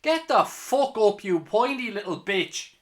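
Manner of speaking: angry shout. Real, not acted. Fan yelling at player on ground.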